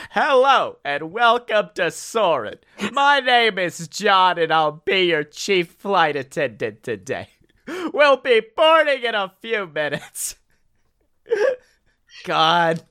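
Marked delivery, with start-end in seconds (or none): 0.1-10.4 bad comedian impression as flight attendant; sing-song welcome announcement in a comedian voice; hammed-up stand-up cadence for a boarding spiel; John Mulaney. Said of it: "doing a bad John Mulaney impression".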